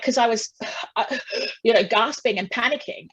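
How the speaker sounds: ordinary talk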